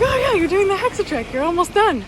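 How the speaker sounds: high, loud, bubbly quote of the guy; eager stranger. Retold or imitated. Imitating the van guy naming her trek, high and eager. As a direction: play the friendly local cheering her on.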